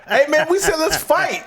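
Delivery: high, loud comic yelling; hammed-up outraged shout; shouted mock protest. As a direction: yell it like someone attacked mid-fight. Acted quarrel over biting in fight.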